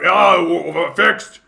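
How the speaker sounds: loud gruff mock shout of triumph; orc shout. Orc mechanic shouting that the tank is fixed, loud mock yell. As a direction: bellow it like a proud dumb orc. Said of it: "and then shout".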